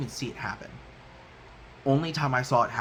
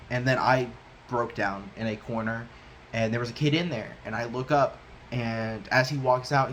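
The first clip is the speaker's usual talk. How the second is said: usual speaking voice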